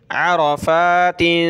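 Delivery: अरफ़ातिन slow, with a full thick r; heavy ra. Shown as a demonstration. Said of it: "रा को मोटा करेंगे".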